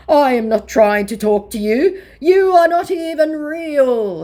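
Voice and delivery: loud, high, overblown declamation; hammed-up indignation, stretched out at a high pitch; mock scammer declaring. Vlogger reading out the scammer's retort, hammed-up and declaiming. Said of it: "he declares".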